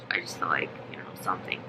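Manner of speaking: whispered confession; whispering. Real, not acted. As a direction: drop to a whisper, suspicious. Confiding a suspicion.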